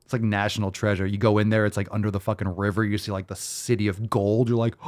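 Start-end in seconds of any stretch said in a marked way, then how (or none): none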